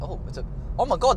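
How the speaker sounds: usual speaking voice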